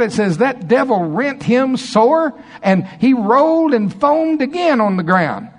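high, intense preacher voice; preaching at full force. Acted. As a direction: build the sermon to a fiery climax.

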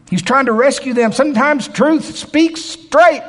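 loud, high, impassioned preaching; preaching at full force. Acted. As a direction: pound the point home to the congregation.

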